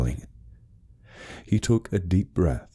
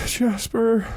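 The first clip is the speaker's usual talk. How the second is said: desperate plea. Acted. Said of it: "he breathed, his voice small and desperate".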